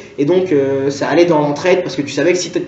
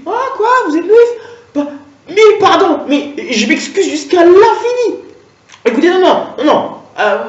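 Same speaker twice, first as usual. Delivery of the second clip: high, laughing, grovelling apology; fawning cashier; 3 semitones higher than usual. Acted. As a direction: gush apologies like a panicked clerk. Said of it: "Donc elle, tout de suite".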